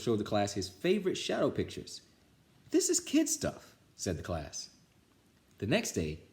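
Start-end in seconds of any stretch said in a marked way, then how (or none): 2.7-3.7 very high, slow whine; bored kids complaining. Acted. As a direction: whine it scornfully. Class complains.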